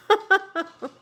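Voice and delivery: a sudden high, loud laugh; high burst of laughter. Real, not acted. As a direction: laugh out at your own retort. Laughing at her own retort.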